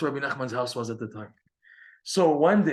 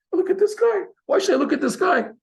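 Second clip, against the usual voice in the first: much higher, louder, baffled disbelief; incredulous outburst; 7 dB louder than usual. Acted. Busy merchant to rebbe, scoffing in loud disbelief. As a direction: scoff as if the question were absurd.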